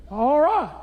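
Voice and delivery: put-on high, sweet old mother; mama's high voice. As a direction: pipe it up like his mama. Mother's reply voiced in story.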